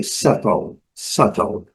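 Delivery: British settle with a crisp T; teacher showing the British accent on settle; British English settle. Shown as a demonstration. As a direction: keep the T in settle clear, British style. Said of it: "No inglês britânico, a letra T, ela é bem característica".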